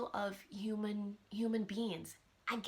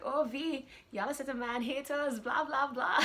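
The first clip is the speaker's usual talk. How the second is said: higher, louder mocking complainer; mock hate messages; 5 semitones higher, 5 dB louder than usual. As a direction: imitate whining haters, breaking into laughter. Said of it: "all these hate messages again and be like".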